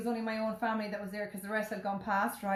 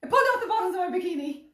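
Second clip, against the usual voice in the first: high, loud, giggling retelling; laughing storytelling; 11 semitones higher, 7 dB louder than usual. Real, not acted. Telling Ciara about the bikini, high giggling. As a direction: tell it through laughter.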